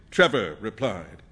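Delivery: usual speaking voice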